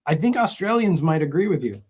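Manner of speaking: ordinary talk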